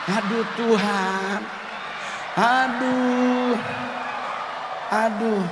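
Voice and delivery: high, loud pained groans of aduh; comic anguish. Retold or imitated. Comedian reliving the koteka moment, high loud wails. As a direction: wail in hammed-up embarrassment.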